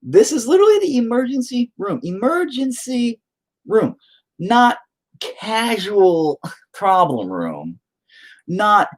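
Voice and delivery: high, strained comic incredulity; exasperated rant. Acted. Father explaining what emergency room means, high exasperated emphasis. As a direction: spell out each word in high-pitched exasperation.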